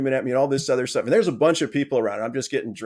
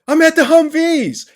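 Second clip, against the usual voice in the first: much higher, louder and slower, yelling; angry colonel shout; 11 dB louder, slower than usual. Retold or imitated. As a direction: blast it back like a furious colonel. Colonel's angry radio answer.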